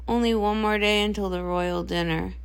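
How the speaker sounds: dreading tomorrow; gloomy dread. Acted. Dreading sister answers in parallel.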